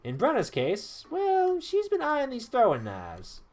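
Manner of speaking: high, slow, drawn-out teasing reveal; genial weapon master. Acted. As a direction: stretch the words as you present a gift. Voices Traherne presenting knives.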